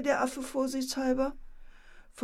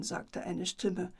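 ordinary delivery, nothing marked

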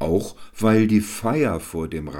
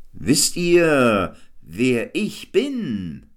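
loud, high, drawn-out jester's call; 7 semitones higher, 6 dB louder, much slower than usual. A narrator's reading. Jester on stage calls out slowly to the crowd.

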